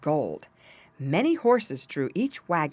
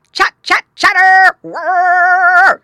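very high, very loud chattering trill; imitated squirrel chatter; 13 semitones higher, 17 dB louder, much slower than usual. A narrator's reading. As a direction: squirrel call ringing through the woods. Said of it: "a noise like".

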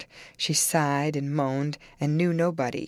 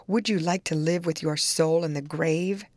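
ordinary delivery

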